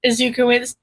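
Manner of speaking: plain reading voice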